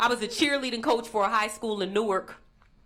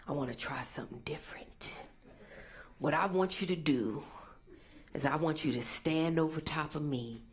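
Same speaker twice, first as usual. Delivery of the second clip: creepy client whisper; 8 semitones lower, 8 dB quieter than usual. Retold or imitated. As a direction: whisper the strange request like a creepy customer. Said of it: "he's like".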